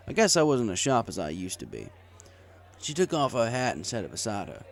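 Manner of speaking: usual speaking voice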